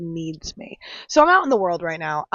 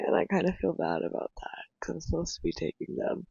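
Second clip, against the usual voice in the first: guilty whisper; 9 dB quieter than usual. Real, not acted. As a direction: whisper it, a bit ashamed. Guilty confession, unvoiced.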